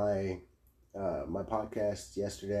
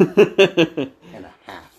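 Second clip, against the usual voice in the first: laughing; 8 semitones higher, 14 dB louder, much slower than usual. Real, not acted. Joke punchline, laughing.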